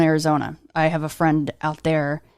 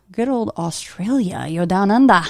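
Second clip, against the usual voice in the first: put-on horrible Australian accent; 3 semitones higher than usual. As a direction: do a bad American impression of an Australian. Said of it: "That was my horrible impression of your accent".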